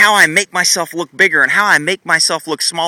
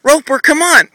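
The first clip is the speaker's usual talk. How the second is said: higher, whiny nagging call; 7 semitones higher than usual. Shown as a demonstration. Owner demonstrating nagging to a horse, higher whiny call. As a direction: nag the horse like most people do. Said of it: "I'm gonna show you what nagging and what most people do".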